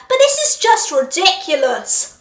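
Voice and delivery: laughing through the line. Real, not acted. Reviewer amused by the marriage joke, laughing. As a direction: crack up at the joke.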